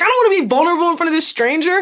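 high, rushed whine of reluctance; reluctant inner protest. Retold or imitated. Embarrassed sibling dreading openness before a stranger, mock-whiny. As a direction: blurt it as a mock panicked thought.

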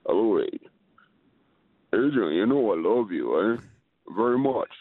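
low mumbling movie-boxer impression; Sylvester Stallone. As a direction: mumble it low, like full mumble rocks. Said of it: "in a Sylvester Stallone voice".

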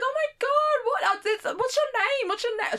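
high-pitched imitated gushing; gushing excitement. Acting out the star's reply to a fan, high gushing excitement. Star reacts warmly to fan, retold.

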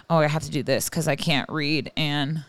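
normal talking voice

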